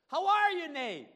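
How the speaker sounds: very high, booming hail; big, hammed-up hello; shouted greeting. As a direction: holler the question across to a friend. Acts pastor greeting Nate.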